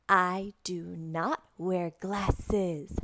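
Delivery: word-by-word model sentence; careful, deliberate teacher voice; slow sentence modelling. Shown as a demonstration. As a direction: slowly space out every word. Models full sentence for repetition.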